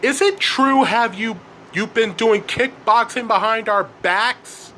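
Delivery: high-pitched accusing mom; mom confronting. A narrator's reading. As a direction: demand an answer as a shocked mother. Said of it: "the mom asked".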